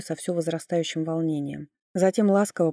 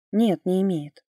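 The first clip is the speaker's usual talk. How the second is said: louder, firm denial; firmly; 2 semitones higher, 4 dB louder than usual. A narrator's reading. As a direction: say it flat and decisive. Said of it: "твёрдо сказала я". (твёрдо)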